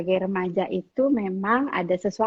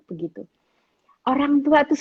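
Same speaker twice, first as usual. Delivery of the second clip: normal talking voice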